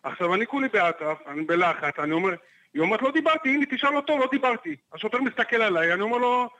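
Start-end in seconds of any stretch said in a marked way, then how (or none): 3.0-4.8 mock-protesting; woman arguing with the policeman, indignant; arguing with the cop. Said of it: "מתחילה לריב איתו".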